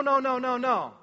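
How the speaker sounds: ordinary talk, nothing marked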